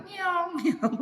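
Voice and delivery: slow, lightly voiced kitten mew; meowing like a cat. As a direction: mew meekly like a tame cat. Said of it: "kayak kucing sudah". (meong pelan seperti kucing)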